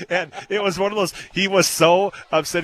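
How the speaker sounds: amused, laugh caught in the voice. Real, not acted. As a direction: still chuckling as the story goes on. Laughing while retelling the tuna joke.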